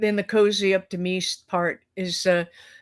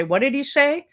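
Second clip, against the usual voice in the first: higher-pitched, mock baffled question; puzzled question; 7 semitones higher than usual. Coworkers in the cafeteria asking about the Scottish host, puzzled. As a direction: imitate people who couldn't follow him.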